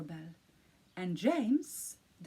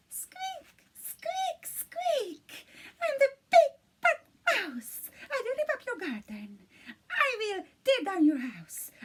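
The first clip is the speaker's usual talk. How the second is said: very high squeaky mouse, big and loud; 9 semitones higher, 4 dB louder than usual. A narrator's reading. Narrator reads the mouse's note again in a high squeaky voice. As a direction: squeak it out like a tiny mouse playing tough.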